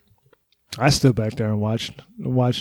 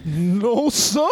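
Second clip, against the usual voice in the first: high-pitched servile character voice; imitating a comedian's meek bit character; meek, climbing high answer; Damon Wayans; 7 semitones higher than usual. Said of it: "great Damon Wayans bit where he's like".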